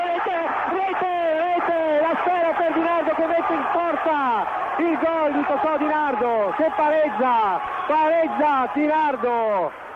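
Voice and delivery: excited goal call; goal shout. Real, not acted. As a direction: roar the goal.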